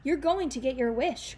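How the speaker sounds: angry rebuke. Acted. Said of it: "Granny Relda said".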